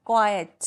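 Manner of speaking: क्वाइट said as kwaai-uht, both vowels sounded; teacher carefully sounding क्वाइट in two beats; two-syllable demo. Shown as a demonstration. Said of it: "sound होता है ना". (क्वाइट को क्वाइ-अट, दो हिस्सों में बोलें)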